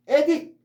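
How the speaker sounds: 에딕 punched high and loud on 에; front stress. Shown as a demonstration. Teacher demonstrating 에딕 with front stress, loud. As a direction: hit 에 of 에딕 hard. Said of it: "앞에 강세가 있어서 에딕".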